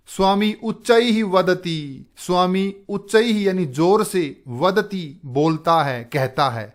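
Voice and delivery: ordinary talk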